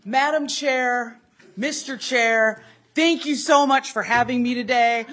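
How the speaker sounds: big, booming oratory, high and slow; Wolgie impression. Doing a colleague impression for the committee chairs, grandly loud. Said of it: "should I do my Wolgie impression".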